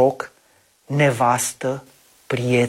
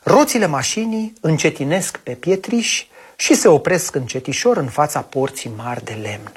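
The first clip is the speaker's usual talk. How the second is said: ordinary delivery, nothing marked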